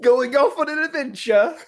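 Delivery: high-pitched mock game lady; imitated game character. Co-host echoing the annoying game lady, high-pitched mimicry. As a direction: echo the silly greeting in a high voice. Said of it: "or she'll be like".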